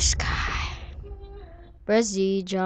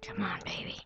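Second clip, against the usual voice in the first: whisper; 12 semitones higher, 19 dB quieter, much faster than usual. Real, not acted. Tense play, urging character.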